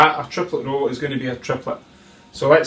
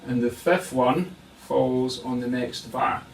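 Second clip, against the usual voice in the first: usual speaking voice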